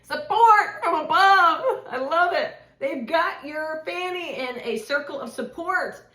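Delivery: real rush of joy breaking into laughing tears; overjoyed outburst. Real, not acted. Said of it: "I don't care if that's loud".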